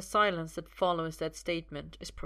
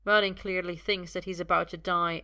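ordinary delivery

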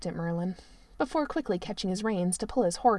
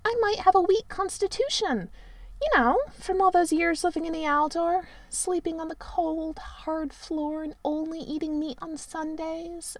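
Merlin protesting; 8 semitones higher than usual. A narrator's reading. Merlin protests to the prince that he might die. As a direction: whine it out in protest.

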